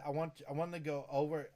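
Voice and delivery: ordinary talk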